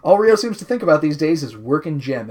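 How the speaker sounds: usual speaking voice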